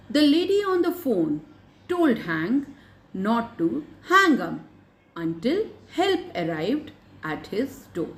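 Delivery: put-on very slow, measured reading for young learners; story read-aloud. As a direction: read it out phrase by phrase, slowly. Reads story aloud to pupils. (बच्चों के लिए धीरे-धीरे कहानी पढ़ना)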